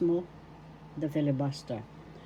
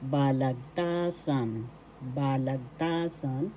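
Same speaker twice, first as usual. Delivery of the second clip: slow "Balagtasan", ba-lag-TAA-san; third-syllable stress; 2 semitones lower, slower than usual. Shown as a demonstration. Teacher shows where the accent is, slow "Balagtasan" stressed on ta. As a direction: lengthen the "ta" in "Balagtasan". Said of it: "The third syllable is where the accent is".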